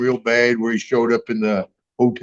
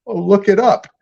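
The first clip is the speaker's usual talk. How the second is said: higher, curt brush-off, lightly imitated; dismissive clerk; 7 semitones higher than usual. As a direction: toss it off like a bored clerk. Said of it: "He goes".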